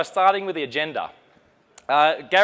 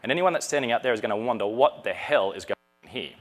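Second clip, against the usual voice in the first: ordinary talk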